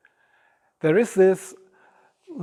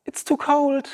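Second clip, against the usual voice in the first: whiny complaint; 10 semitones higher, 4 dB louder than usual. Retold or imitated. Acts timid self at cold bath.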